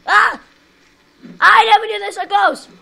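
loud panicked screams; panicked yelling. Real, not acted. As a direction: yell in panic as you crash. Crash in game, yelling.

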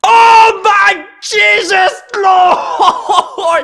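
high, throat-wrecking shout of joy; real screaming. Real, not acted. Player pulls a full-art card, screaming at full pitch. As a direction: scream it out, stretching the words. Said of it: "quand on crie comme ça".

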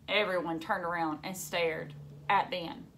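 usual speaking voice

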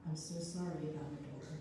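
soft, embarrassed apology; softly. A narrator's reading. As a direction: say it softly, ashamed. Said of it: "she said softly to Sister Agnes".

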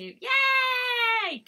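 high, loud, long yay; excited cheer. Real, not acted. Streamer welcoming a viewer back, excited high cheer.

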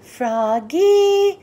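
loud, stretched call; yelled name. A narrator's reading. Dad yells to Froggy as the ball comes, loud. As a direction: shout it across the field. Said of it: "yelled his dad".

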